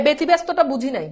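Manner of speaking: usual speaking voice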